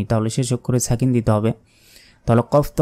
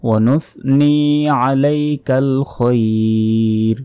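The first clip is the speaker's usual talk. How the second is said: slow recitation with long held vowels; কুরআন তিলাওয়াত; 2 semitones higher, much slower than usual. Shown as a demonstration. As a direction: recite measuredly, stretching the final syllable. Recites the verse being taught. (টেনে টেনে ধীর তিলাওয়াত)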